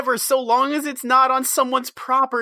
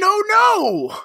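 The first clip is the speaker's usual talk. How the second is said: mock villain; 9 semitones higher, 4 dB louder than usual. Mock cartoon villain dismay.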